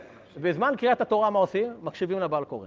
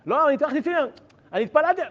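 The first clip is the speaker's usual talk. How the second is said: much higher and louder, insisting; 11 semitones higher, 5 dB louder, faster than usual. Retold or imitated. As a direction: plead your case urgently.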